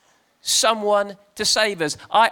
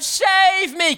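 high-pitched cry for help; mock scream; 10 semitones higher than usual. Rider screaming for help on a galloping horse, high mock scream. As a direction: scream out for rescue, hammed up. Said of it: "And I screamed out".

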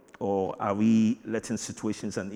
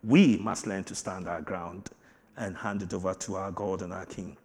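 usual speaking voice

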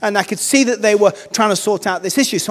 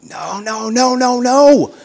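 building protest; 2 semitones higher, much slower than usual. Retold or imitated. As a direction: let the denial swell up. Vicar's inner protest at refusal.

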